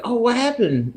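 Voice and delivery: a hint of a worried kid; retold coworker. Coworker asks what happened.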